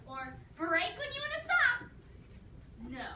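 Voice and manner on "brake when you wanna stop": much higher, louder instruction; mock driving teacher; mock instructor. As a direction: call it out like a basic tip.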